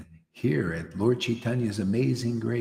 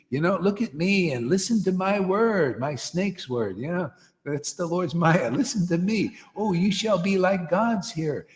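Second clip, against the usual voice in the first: tempting serpent; 6 semitones higher than usual. Retold or imitated. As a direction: lure them in like the serpent in the garden. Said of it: "The snake says".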